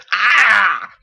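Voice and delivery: high, loud choked cry; pained gasp. A narrator's reading. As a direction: gasp after the smoke. After coughing.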